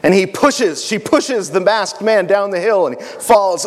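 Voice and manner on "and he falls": ordinary delivery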